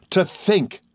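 high, marvelling exclamation; excitable friend. A narrator's reading.

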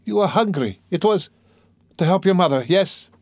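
high, eager pleading guess; sudden cry. A narrator's reading. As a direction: burst out with sudden hope. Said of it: "but suddenly she cried".

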